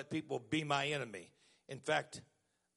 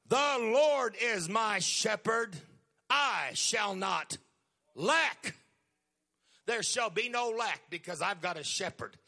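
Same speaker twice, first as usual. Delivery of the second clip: slow, loud, solemn scripture recital; each phrase weighed and drawn out; psalm quoted slowly; 4 semitones higher, 4 dB louder than usual. Shown as a demonstration. Preacher showing how to quote the psalm, slowly. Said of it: "you quote a scripture from Psalms".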